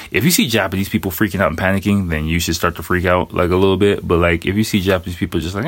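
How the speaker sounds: ordinary talk, nothing marked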